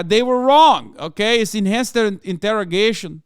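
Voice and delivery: high, loud, hammed-up Brazilian accent. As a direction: ham up a far-right Brazilian grandson in a thick Brazilian accent. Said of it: "the Alberto Hitler guy will be like".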